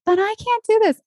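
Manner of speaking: high-pitched protest of a doubter; doubting listener. Acted. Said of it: "if you're sitting there listening and you're like".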